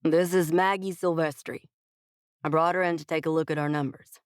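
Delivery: Texan boss, curt and to the point; brusque Texas twang. A narrator's reading. CEO introducing the consultant to staff, brusque Texas twang. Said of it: "his brusque introduction".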